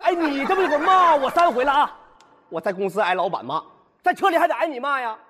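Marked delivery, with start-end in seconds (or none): none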